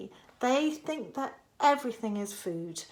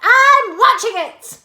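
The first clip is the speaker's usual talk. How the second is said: high, loud granny; high loud old woman; Grandma shouting back; 12 semitones higher, 14 dB louder than usual. A narrator's reading. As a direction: shout down to George.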